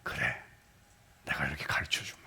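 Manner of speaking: soft, tender whispered reply; gentle whisper of God. Retold or imitated. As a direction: whisper it kindly, like a patient father. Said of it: "그렇게 하신다는 거예요". (다정하게 속삭이는 하나님)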